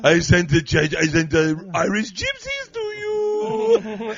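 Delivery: very high, slow, loud vampire voice; Adam Sandler vampire. Pretend. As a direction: stretch it out, goofy vampire. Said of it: "Adam Sandler vampire".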